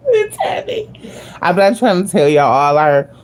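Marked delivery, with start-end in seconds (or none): 0.0-0.9 high giggle breaking through the words; laughing. Real, not acted. Gushing to viewers about attraction, laughing. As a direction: laugh it out, a little embarrassed.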